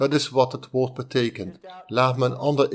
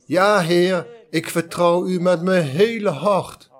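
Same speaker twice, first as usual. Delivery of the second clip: higher, louder earnest vow; eager vow; 6 semitones higher, 4 dB louder than usual. Acted. Man on the cliff assures God of his trust, higher and loud. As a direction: promise your whole heart, loudly. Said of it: "En hij zou zeggen".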